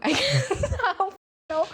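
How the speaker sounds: high laughing shrug. Real, not acted. Laughing after 'you're welcome'.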